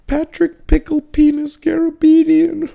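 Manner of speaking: very high falsetto mock taunt; sing-song it like a drunk roommate teasing you. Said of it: "He'd be like".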